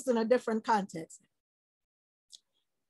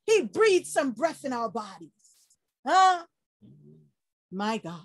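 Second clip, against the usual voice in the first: usual speaking voice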